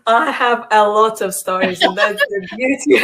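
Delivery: loud, laughing through the words; bubbling over with laughter as she answers; real laugh breaking into the answer. Real, not acted. Laughing while answering the question.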